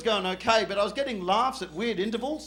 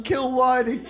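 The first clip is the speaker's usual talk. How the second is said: mild mock yell, barely raised; retold yell; 3 dB louder, slower than usual. Comedian boasting to his UK mates, mild retold yell. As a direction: report the chant without really shouting. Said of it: "I got away with yelling".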